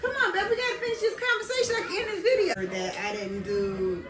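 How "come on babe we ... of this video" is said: excited. Real, not acted. Chatting up to the hairdresser.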